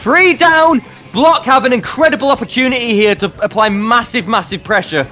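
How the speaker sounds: rising hype, calling the fight fast; excited play-by-play. Acted. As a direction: yell it as the push lands. Live esports play-by-play.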